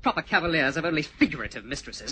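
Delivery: normal talking voice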